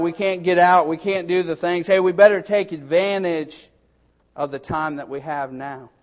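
usual speaking voice

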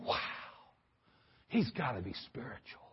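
mock-awed onlooker, voice falling away; low, soft amazement; hushed awe. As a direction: drop low and soft, as if impressed. Imagined onlooker awed at Bible expert.

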